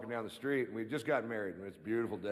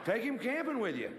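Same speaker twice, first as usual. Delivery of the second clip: high, pitch climbing, a devotee gushing; excited fan rant; 10 semitones higher than usual. Retold or imitated. Comic channelling the [name] fan, gushing high and rising.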